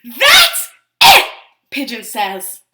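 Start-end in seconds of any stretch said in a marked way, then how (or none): 0.0-1.3 very loud, high cartoon yell. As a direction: blow up at the duckling. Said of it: "it looks like he's yelling".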